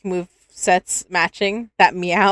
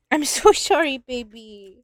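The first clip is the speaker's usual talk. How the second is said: laughing pet apology; 3 semitones higher, slower than usual. Real, not acted.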